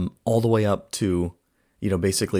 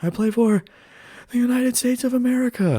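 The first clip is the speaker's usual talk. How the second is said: proud imitated shout, very high and louder; Rizzo; 4 dB louder than usual. Player answering the coach's question, imitated high shout. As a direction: holler it out as a high declaration. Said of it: "And Rizzo's like".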